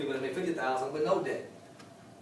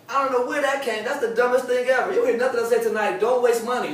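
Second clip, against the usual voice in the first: loud preaching; 7 semitones higher, 6 dB louder than usual. Real, not acted. Preacher raising voice on waste.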